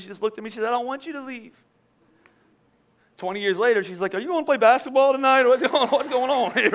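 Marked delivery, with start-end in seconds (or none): 0.7-1.6 soft, higher imitation of a wife; pleading new wife. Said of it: "You know how wives do this". 4.1-6.7 higher, mock-impatient wife voice. Wife's changed attitude years later.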